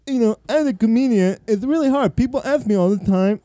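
high mock deaf accent; imagined deaf Canadian comedian. Predicting the deaf Canadian comedian's act, mock deaf accent. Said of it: "it's all gonna be like".

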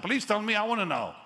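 eager pleading. Retold or imitated. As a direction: beg him to tell you. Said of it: "I said".

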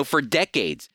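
normal talking voice

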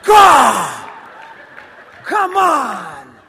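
high holler with drawn-out cries; mock exasperated shout. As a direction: cry out in comic exasperation. Mock exasperated outburst at audience.

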